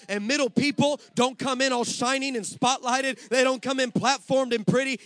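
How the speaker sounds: loud, high, rhythmic sermon build; preaching at full force. Acted. Pastor rousing his congregation, loud and rhythmic. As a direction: drive each line home to the church.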